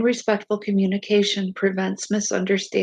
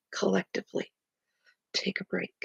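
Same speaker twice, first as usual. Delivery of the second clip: fading to a hush; calm, soft; 9 dB quieter than usual. Shown as a demonstration. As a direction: let it sink in quietly.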